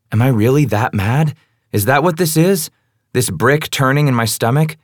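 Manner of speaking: ordinary delivery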